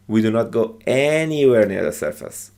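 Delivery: long emphatic ANYWHERE, falling away after it; stress on ANYWHERE. Shown as a demonstration. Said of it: "we say that louder".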